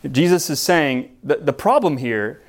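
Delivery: usual speaking voice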